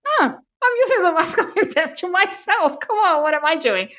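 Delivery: high, mock-exasperated aside to herself; self-mocking exclamation. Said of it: "And I'm even like".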